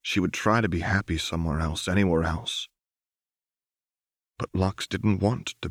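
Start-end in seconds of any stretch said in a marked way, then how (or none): none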